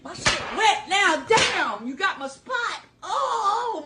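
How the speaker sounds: usual speaking voice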